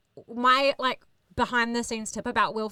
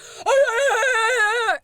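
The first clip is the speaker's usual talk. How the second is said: loud high whooping laugh; mock entourage cheer; hype crowd whoop; 11 semitones higher, 7 dB louder than usual. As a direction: whoop it up like a posse. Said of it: "the hype people are like".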